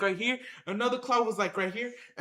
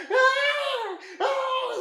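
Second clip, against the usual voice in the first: shrill, much higher, loud yelled cry; scream; 12 semitones higher, 4 dB louder than usual. Retold or imitated. People pulling him onto the leaving truck, high panicked scream. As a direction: scream like you're being yanked off your feet.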